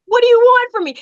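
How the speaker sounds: loud, very high protest; exasperated outcry. Retold or imitated. Pleading with critics of her speech, exasperated cry. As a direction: cry out in mock despair.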